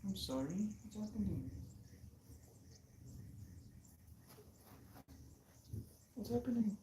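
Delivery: very high, very soft tender coo; soothing pet talk. Real, not acted. Calming the upset puppy, high soft coo. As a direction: comfort her gently, barely voiced.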